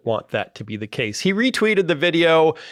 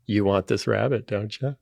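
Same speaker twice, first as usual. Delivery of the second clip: soft, coaxing tempter; tempting voice; 3 semitones lower, 3 dB quieter than usual. Pretend. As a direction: lure the snake quietly. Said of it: "We are now tempting the snake".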